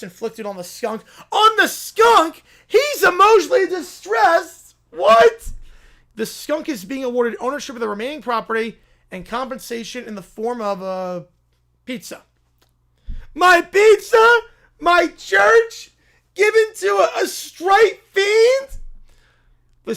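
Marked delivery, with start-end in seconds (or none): 1.3-5.5 incredulous shouts; can't believe the verdict; louder stunned outcry; shocked disbelief. Acted. Reacting to absurd ruling. 13.3-18.8 slow incredulous shout; stunned that his pizza is gone; shocked wail; aghast outrage. Acted. Pizza given to skunk.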